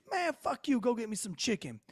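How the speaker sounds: high-pitched imitation of a rude woman; mock defiant demand. Tarsha swears back at the officer, high mock defiance.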